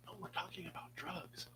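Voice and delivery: hushed conspiratorial whisper. Real, not acted. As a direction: whisper it like a secret. Conspiratorial aside about drugs.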